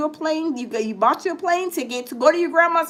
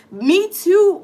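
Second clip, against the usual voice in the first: louder, higher me too; emphatic agreement; 5 semitones higher, 4 dB louder than usual. Real, not acted. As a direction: call Me too out with a push. Agreeing with One Love.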